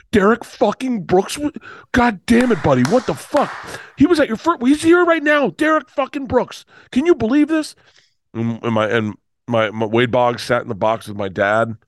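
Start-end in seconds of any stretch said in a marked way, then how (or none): 0.1-7.7 much higher, excited disbelief as the dad; starstruck dad. Retold or imitated. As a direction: play the dad losing it over a famous athlete. Dad's starstruck outburst voiced.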